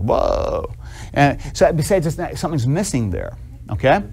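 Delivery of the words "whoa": low, drawn-out mock whoa; mock stunned child. Junior reacting to mom calling the pastor, mock stunned. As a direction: lightly act a kid taken aback, voice dropped.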